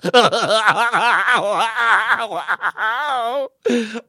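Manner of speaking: long helpless laughing fit. Real, not acted. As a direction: break into a full laughing fit. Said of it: "laughing fit".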